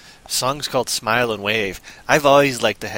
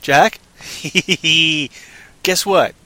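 ordinary talk, nothing marked

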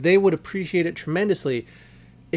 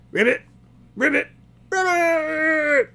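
croaks climbing higher into a cry; excited frog croaks; 10 semitones higher, slower than usual. Acted. Happy vampire frogs cheering for the blood, croaks rising to a cry.

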